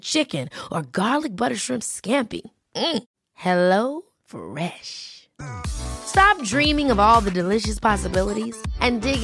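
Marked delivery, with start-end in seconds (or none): none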